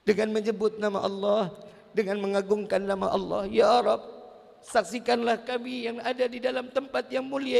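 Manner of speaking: high, earnest prayer voice. Acted. Preacher opening a prayer before the congregation, high and earnest. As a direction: lift the prayer up to God.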